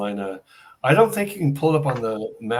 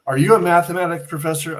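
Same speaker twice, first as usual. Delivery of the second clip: higher, louder, a mock-startled question; awkward blurted question; 7 semitones higher, 6 dB louder, faster than usual. Asking the stranger a blurted, startled question. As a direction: blurt it out, caught off guard.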